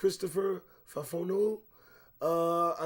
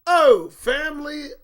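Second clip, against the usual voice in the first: dramatic headline read; 10 semitones higher, 15 dB louder, slower than usual. Real, not acted.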